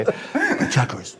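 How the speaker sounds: very high, very slow Trump voice breaking into a laugh; Trump impression.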